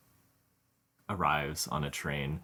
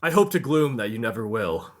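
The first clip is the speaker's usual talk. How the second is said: worried bodyguard; 5 semitones higher, 8 dB louder than usual. Acted. Jason warns Griff again.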